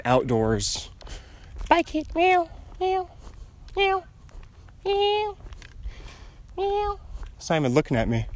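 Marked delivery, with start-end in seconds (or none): 1.7-7.0 very high little meows to a kitty; high sing-song cat talk; cooing at a cat. Real, not acted. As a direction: call the cat in a tiny high voice. Calling after the cat.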